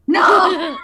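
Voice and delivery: a loud, high surprised "no"; mock surprise. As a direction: cry out in hammed-up shock. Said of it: "my surprise face going".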